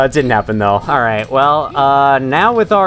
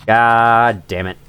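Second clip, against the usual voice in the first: drawn-out, low-pitched swearing; slow exasperated curse; 5 semitones lower, slower than usual. Real, not acted. Gamer facing a fetch quest, slow exasperated curse. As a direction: stretch each word out in resignation.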